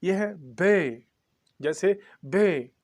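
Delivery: बे drawn out slowly; slow बे with a clear e; slow letter demo. Shown as a demonstration. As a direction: teach बे slowly and deliberately. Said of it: "इसके बाद क्या है?".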